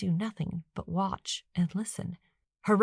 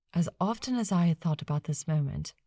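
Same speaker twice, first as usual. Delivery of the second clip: usual speaking voice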